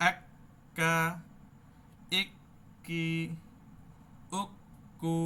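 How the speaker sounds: আক্কা with a held stop on ক্ক; doubled kaf drill. Shown as a demonstration. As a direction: block and release the double ক্ক. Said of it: "অটা কফ আর কাফে আ".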